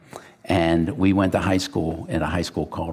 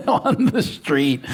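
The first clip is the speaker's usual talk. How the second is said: laughing through the words, slowed; retelling a crash while cracking up; 7 semitones higher, slower than usual. Retold or imitated. Friend retelling his bike crash, laughing as he speaks. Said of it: "he's laughing at himself".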